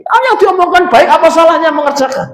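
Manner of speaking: a breezy believer talking himself through it; lightly voiced cheerful man. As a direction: shrug it off brightly. Voicing a person with pure intent.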